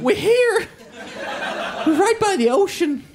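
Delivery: very high, loud mock delight; mock excited. Lost passengers phoning their friend in Birmingham, mock excited. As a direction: squeal it like thrilled arrivals.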